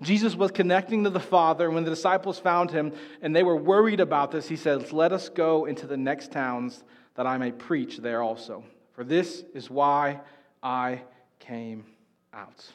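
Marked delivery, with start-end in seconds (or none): 4.9-12.7 slow, lower and softer, settling; calm reply. Acted. Jesus answers the worried disciples, calm and slow. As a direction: answer gently and with quiet resolve.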